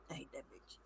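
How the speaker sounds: barely audible voiceless whisper; whispered disdain. Acted. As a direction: mouth it secretly under your breath. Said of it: "your face scrunches up like".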